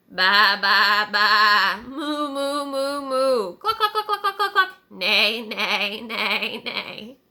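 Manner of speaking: loud baa, moo, cluck and neigh babble; a panicked barnyard chatter; worried animal noises. Acted. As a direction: animals bursting into worried calls. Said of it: "it would have sounded something like this".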